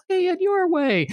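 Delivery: hammed-up breezy high voice; easygoing DM. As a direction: imitate the chill anything-goes game master. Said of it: "I'm normally the guy that's like".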